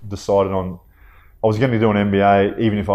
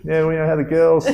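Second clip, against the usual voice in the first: higher, knowing, chummy voice; taxi driver; 8 semitones higher, 3 dB louder than usual. Retold or imitated. Taxi driver showing he knows the family, high and chummy. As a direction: play the cabbie who knows everything. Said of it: "the guy turns and goes".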